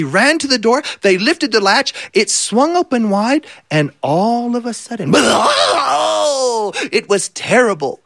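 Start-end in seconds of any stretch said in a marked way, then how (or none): 5.2-6.9 menacing triumphant laugh; big bad wolf bursting in laughing; wolf's evil laugh. A narrator's reading.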